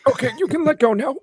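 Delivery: very high, strained squeak; squeezed high voice. Acted. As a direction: squeak out while crushed in a hug. Squeezed in a hug.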